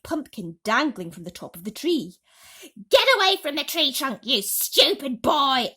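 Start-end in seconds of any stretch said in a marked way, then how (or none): 2.9-5.7 loud, high furious yell; angry yell. A narrator's reading. Aunt yells at James to leave the tree, furious. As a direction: yell at the boy to get away. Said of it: "Aunt Spiker yelled".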